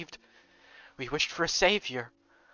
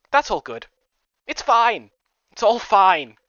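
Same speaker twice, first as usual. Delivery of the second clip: forced cheer; 4 semitones higher, 9 dB louder, slower than usual. Acted.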